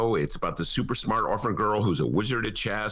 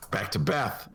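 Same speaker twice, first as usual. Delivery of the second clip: usual speaking voice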